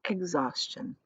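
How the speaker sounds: ordinary delivery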